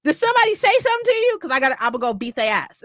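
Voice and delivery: high, fast, rushed mock threat; ready to fight, fast and high-pitched; mimicking a fired-up friend. Friend from the comments ready to fight for her, fast and high.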